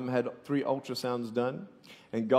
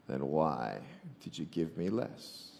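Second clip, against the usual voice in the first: a much lower, softer, heartbroken question; hurt prayer; 8 semitones lower, 9 dB quieter, slower than usual. Retold or imitated. Boy asks God why he got less, low and soft. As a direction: let it sink quietly in hurt. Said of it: "And I said".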